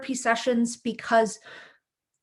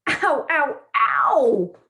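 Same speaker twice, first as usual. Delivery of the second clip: louder, hammed-up cries of pain; exclaimed in pain; 2 semitones higher, 6 dB louder, much slower than usual. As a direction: yelp it like a cartoon ouch. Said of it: "exclaimed Brick and Stick".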